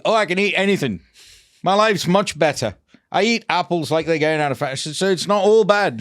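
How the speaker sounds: lightly voiced upbeat patient; imitating a chirpy patient. Dentist voicing the patient bragging about apples, light mock cheer.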